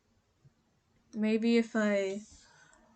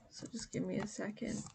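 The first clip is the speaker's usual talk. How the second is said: whispering; 13 dB quieter than usual. Real, not acted. Aside while multitasking.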